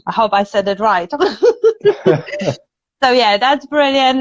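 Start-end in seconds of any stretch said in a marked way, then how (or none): 1.2-2.5 high giggle at oneself; self-amused laugh. Real, not acted. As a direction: giggle at your own attempt. Laughs at own Italian attempt.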